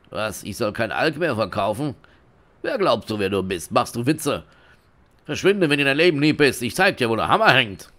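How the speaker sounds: acted anger building to loud threat; indignant dealer. Dealer told to stop selling booze, hammed-up angry threat. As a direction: start incredulous, end loudly menacing.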